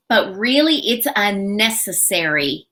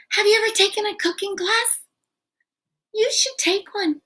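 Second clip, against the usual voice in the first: high, quick and airy; light, weak suggestion; 11 semitones higher, faster than usual. Shown as a demonstration. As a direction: float the question up lightly. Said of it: "very general, very low on the suggestion scale".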